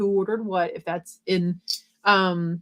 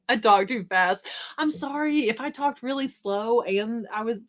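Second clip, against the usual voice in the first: usual speaking voice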